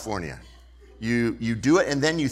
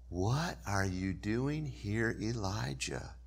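quiet voice; 3 semitones lower, 9 dB quieter, slower than usual. Acted. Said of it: "And a voice said".

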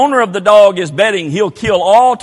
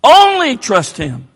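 slow, louder closing over music; closing appeal; 3 semitones higher, 5 dB louder, much slower than usual. Real, not acted. Preacher ends his altar call over the music, slow. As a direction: ease into the invitation, slow.

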